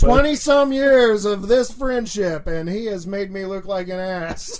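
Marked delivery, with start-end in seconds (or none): 0.0-2.5 loud, slow, higher laughing complaint; mock outrage. Pranked friend complains to listeners, loud mock outrage. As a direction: proclaim the grievance to the audience.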